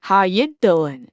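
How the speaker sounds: lightly put-on New York "How you doing"; New York "How you doing?" tried on chat; New Yorker accent. Shown as a demonstration. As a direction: try the New York greeting, drawn out. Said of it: "Do I sound like a New Yorker".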